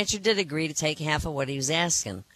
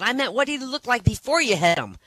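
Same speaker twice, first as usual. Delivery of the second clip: exasperated wife; 7 semitones higher, 4 dB louder than usual. Acted. Wife scolds man over dead cat.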